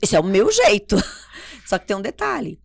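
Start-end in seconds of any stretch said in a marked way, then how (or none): 0.0-0.9 loud self-parody, breaking into a laugh after; mock shouting. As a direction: blurt it loudly as a joking self-defence. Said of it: "porque eu não tô gritando".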